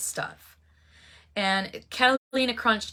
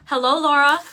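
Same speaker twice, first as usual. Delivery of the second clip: high, loud cheerful hello; bright greeting; 7 semitones higher, 10 dB louder than usual. Real, not acted. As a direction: greet a viewer warmly. Greeting a viewer who joined.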